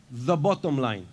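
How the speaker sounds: ordinary talk, nothing marked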